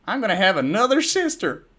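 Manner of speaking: high, loud joyful shout; excited shout. A narrator's reading. Apple Bloom shouting to Big Mac, high and loud with joy. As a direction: shout it while jumping for joy. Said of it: "She shouted with a jump".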